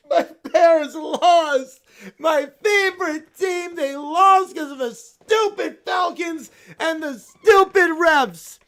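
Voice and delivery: stomp through the complaints like a sore loser; whiny tantrum. Acted. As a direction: bear fan sulking furiously at the loss. Bear yells at mom.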